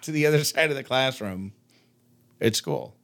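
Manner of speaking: usual speaking voice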